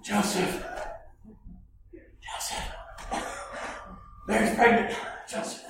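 breathless, excited gasping; out of breath. Retold or imitated. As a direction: gasp out the news, out of breath. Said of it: "so filled with excitement and out of breath".

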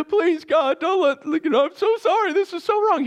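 high, whiny pleading; David voiced begging God for mercy, high and whiny; whiny fake apology. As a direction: beg in a mock-pitiful whine.

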